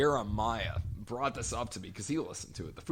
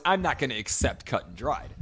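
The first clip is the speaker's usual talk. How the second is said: ordinary talk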